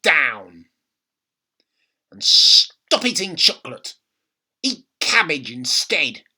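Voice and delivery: shrill commanding granny; bossy grandma. A narrator's reading. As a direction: bark orders like a nagging grandmother. Grandma voice, loud and stern.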